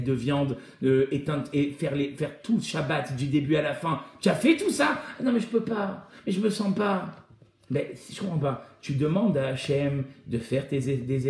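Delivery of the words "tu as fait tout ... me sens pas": mock dialogue with excuse-maker. Challenge then mock excuse.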